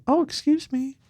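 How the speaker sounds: high, soft mock-polite excuse me; mock polite woman. Mimicking the woman who sat down, high and polite. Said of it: "this chick walks up".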